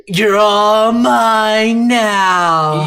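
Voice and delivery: very slow, loud villain threat; menacing villain. Retold or imitated. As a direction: draw out the gloating threat. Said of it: "Sarah's like".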